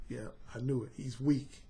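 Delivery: low hushed purr; soft satisfied murmur; quiet, low and pleased; smooth serpent. Retold or imitated. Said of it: "that serpent was smooth".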